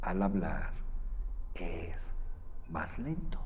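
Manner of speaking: drawn out, fading to a whisper; slow right down and let it die away; slow feeling talk. Shown as a demonstration. Coach demonstrating slow emotional speech, hushed. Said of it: "Lo estoy exagerando un poco".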